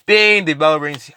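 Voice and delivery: normal talking voice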